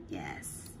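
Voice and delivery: soft whispered yes. Real, not acted. Teacher whispering quiet praise to student. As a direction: breathe the praise out gently.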